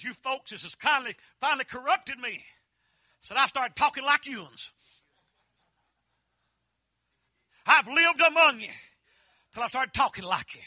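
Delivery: a hammed-up sister-in-law confession; imitating sister-in-law. Sister-in-law voiced in a story.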